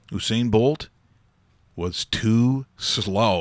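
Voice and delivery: "was too slow" stretched out very slowly; slow, deliberate punchline. Acted. Host delivers the ironic line about Bolt, dragged out. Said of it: "I'm actually gonna say the sentence".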